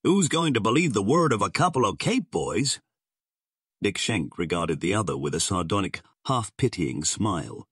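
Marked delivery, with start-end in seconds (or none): 0.0-2.8 higher-pitched scornful protest; restrained shout of disbelief; shouted challenge. A narrator's reading. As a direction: throw the question back heatedly. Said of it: "shouted Gar".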